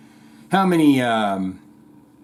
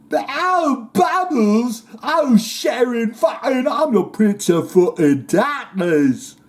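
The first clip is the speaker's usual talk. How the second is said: much higher, slow, slurred rocker whine with a shout; 9 semitones higher, slower than usual. Retold or imitated. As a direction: wail at the bubbles like a dazed rock star. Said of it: "and he's like".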